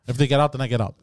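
normal talking voice

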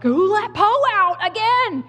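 high, exasperated parent yell; acted holler through the house at the culprit; yelling at kids. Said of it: "I yell at whatever kid may have let the dog out".

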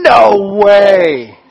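loud no way; disbelief. Acted. Shepherds answering the angel, loud disbelief. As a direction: blurt out shocked disbelief. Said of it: "the shepherds are going".

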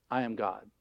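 low, soft, settled declaration; hushed, deep statement of authority; calm divine authority. Acted. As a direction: state it quietly as God. God's calm authoritative answer, acted.

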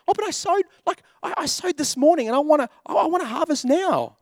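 high, whiny mock impatience; impatient whining believer. Pastor mimics an impatient believer, high mock whine. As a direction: play the believer who wants it now, whining.

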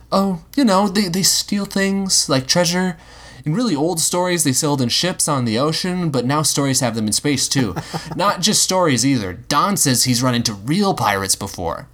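put-on a boy excitedly explaining pirates to his robot; young Will. A narrator's reading. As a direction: chatter like an excited kid.

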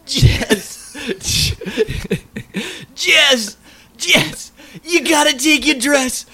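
high, loud, strained pleading for Jess; whiny plea. Retold or imitated. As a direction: beg Jess like a desperate, worn-out man. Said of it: "and he goes".

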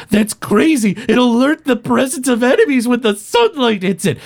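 high-pitched exasperated professor; arguing. Acted. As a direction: protest as if it's absurd. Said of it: "they both start arguing".

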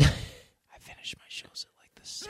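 whispering. Real, not acted.

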